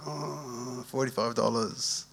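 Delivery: soft, drawn-out "oh" of mock dismay; mildly pained, quietly lamenting the cost; reluctant inner groan. Pastor admits his first thought about the money, reluctant groan.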